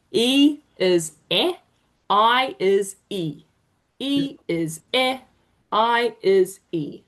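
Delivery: slow chant, "E is eh, I is E"; vowel-name drill. Shown as a demonstration. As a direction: spell out slowly: "eh" as in bed, "E" as in machine. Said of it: "it's sort of confusing".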